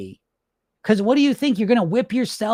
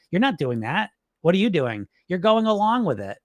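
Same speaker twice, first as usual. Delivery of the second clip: ordinary talk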